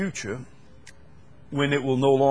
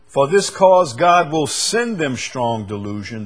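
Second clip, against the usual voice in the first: emphatic reread; 3 semitones higher, 8 dB louder than usual. Shown as a demonstration. Preacher rereading the sober verse, louder. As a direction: hit "cause" hard on the second reading. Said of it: "most sober passages".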